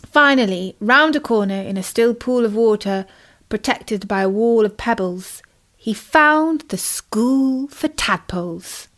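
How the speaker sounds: ordinary delivery, nothing marked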